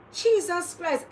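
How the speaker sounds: high, exasperated Jesus Christ; exasperated exclamation. Real, not acted. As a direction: cry out in disbelief. Exclaiming embarrassment.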